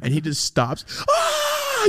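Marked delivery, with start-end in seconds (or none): 1.1-1.8 very high, sudden shriek; startled yelp. Retold or imitated. Charlie caught off guard, a shrill startled yelp. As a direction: yelp like you've been startled.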